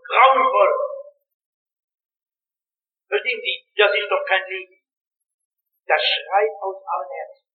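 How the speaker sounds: usual speaking voice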